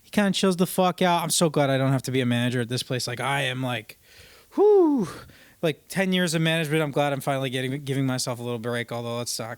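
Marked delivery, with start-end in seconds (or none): 4.5-5.1 loud, high exhaled whew; relieved whew. Real, not acted. Relief at no longer managing.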